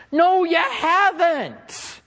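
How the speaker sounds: high, slow, drawn-out no; preacher cutting the claim down; sharp rebuttal. Real, not acted. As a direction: stretch it out, high and firm.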